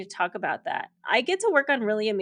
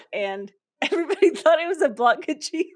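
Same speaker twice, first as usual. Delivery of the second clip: higher and louder, laughing through the words; laughing punchline; 8 semitones higher, 4 dB louder than usual. Real, not acted. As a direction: crack up as you deliver the punchline. Punchline of costume story.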